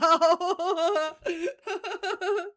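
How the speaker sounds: long, high, loud laughing fit; shrieking cackle of laughter; real laugh. Real, not acted. Cracks up after giving up.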